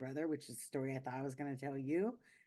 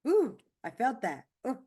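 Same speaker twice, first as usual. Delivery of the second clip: much higher and louder, overcome; moved exclamation; 9 semitones higher, 11 dB louder than usual. Real, not acted. Speaker surprised by her own feelings after telling the story, exclaiming. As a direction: let real emotion burst out after the story. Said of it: "Ooh, I almost started crying".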